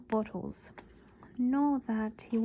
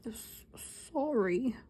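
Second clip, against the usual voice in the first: sobbing apology; slower than usual. A narrator's reading. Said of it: "sobbed Hagrid".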